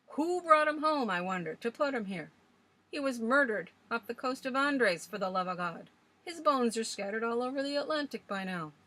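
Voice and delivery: put-on old Swales ranting. A narrator's reading. Old man scoffing at legends to the young ladies, loud and gruff. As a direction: rail against ghost stories like a stubborn old sailor.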